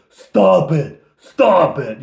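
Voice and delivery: loud, high, slow dim-witted ogre voice with laughs; dumb ogre. Acted. Ogre scoffing at the illusion dragon, loud and slow. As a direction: bellow it like a brainless brute. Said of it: "he pokes at Fleetwood".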